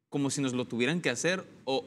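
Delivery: ordinary talk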